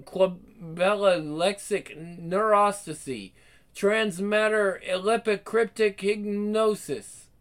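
slow, trancelike strange words; speaking in tongues. Acted. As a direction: intone the nonsense like a trance. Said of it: "What is this gibberish?".

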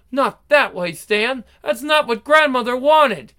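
pleading. Acted. Wife pleads against husband's plan.

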